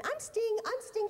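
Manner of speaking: mock [name] boasting. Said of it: "he doesn't run around going".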